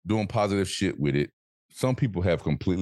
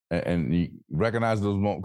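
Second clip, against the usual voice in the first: usual speaking voice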